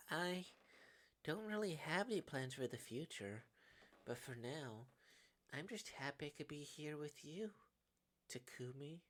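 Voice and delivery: soft, hesitant, intimate delivery; hushed, heartfelt character voice; tender confession. Acted. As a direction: confide it gently with long pauses. Tender beach confession to friend.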